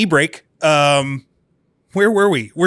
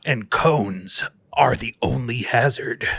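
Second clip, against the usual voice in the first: deep, slow trailer narrator; movie announcer voice; 4 semitones lower, slower than usual. Pretend. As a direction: boom it out like a film trailer. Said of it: "my movie announcer voice".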